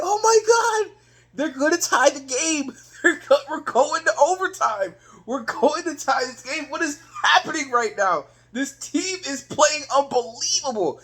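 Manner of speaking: high-pitched, amazed fan reaction; excited disbelief. Retold or imitated. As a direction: marvel as the comeback unfolds. Reliving an exciting fourth-quarter comeback.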